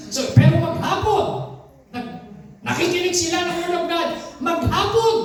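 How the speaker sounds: high, driving preacher's cry building to the last word; fervent preaching. Acted. Pastor rousing the congregation about listeners standing, rising preaching. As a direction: lift the congregation with rising fervor.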